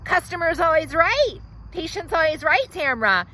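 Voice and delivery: high and loud, bossy; patient bossing the hygienist, high and loud; entitled demand. Acted. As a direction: lay down the law.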